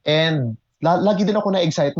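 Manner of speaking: ordinary talk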